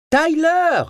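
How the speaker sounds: crier le prénom à pleine voix, aigu; hurlement. A narrator's reading. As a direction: appeler son fils en hurlant à travers la maison. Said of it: "hurla-t-elle". (hurlement aigu)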